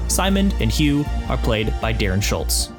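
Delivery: ordinary talk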